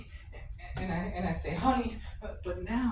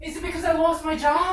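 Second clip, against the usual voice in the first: high, loud desperate question; husband begging to know why; loud pleading; 9 semitones higher, 7 dB louder than usual. Acted. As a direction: plead with her, almost shouting.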